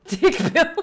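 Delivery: cracking up mid-joke, then a high burst of laughter; laughing. Real, not acted. Player laughing at her own dirty joke about the bio typo. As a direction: laugh through the punchline.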